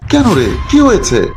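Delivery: high, anxious mother's voice; startled mother asking what happened; worried mother. Acted. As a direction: ask like an alarmed old mother.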